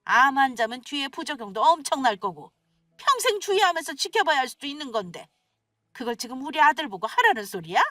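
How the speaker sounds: wailing outrage with high-pitched peaks; lament, then lash out for your son's sake; agitated, angry mother-in-law. Acted. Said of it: "격앙된 말투로 화를 내시기". (격앙되어 화내는 시어머니)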